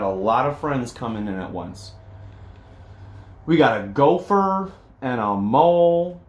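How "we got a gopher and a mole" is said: plain reading voice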